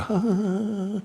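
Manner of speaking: a high, amused scoff of a laugh; high mocking laugh. Teacher scoffing at the mind with a high laugh. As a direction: laugh off the mind's nonsense.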